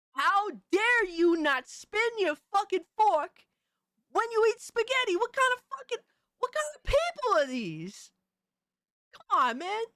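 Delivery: high-pitched hammed-up tirade; mock indignation. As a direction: rant as if mortally offended. Mock tirade about not twisting fork.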